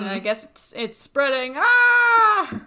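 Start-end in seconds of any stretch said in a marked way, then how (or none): 1.6-2.6 high loud yelp. Acted. Spreading burp joke.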